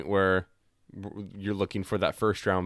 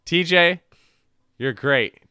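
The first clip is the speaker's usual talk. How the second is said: loud playful shout; 7 semitones higher, 9 dB louder than usual. Real, not acted. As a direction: call it out loud and cheerful. Shouting affection at Laser Dude.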